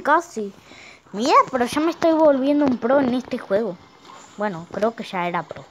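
usual speaking voice

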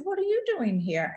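retold startled question, pitch jumping up; surprised question. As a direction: ask it like someone caught off guard. Startled to find boyfriend in office.

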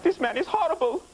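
high-pitched mock Norwegian voice; Norwegian accent. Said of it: "That's my Norwegian".

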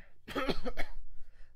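rough coughing between words; coughing fit. A narrator's reading. Courier still choking on the smoke, coughing hard. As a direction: cough the smoke out of your lungs. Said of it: "started coughing their lungs out".

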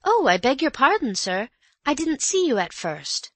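high, bright startled maid voice; a blushing maid caught off guard; flustered maid. A narrator's reading. Maid startled by Mister Cayley, flustered and high. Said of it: "she blushed and said".

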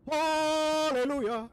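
loud, slow, drawn-out cry of joy; triumphant shout. Real, not acted. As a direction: belt it like a victory cry. Finally gets rid of seed.

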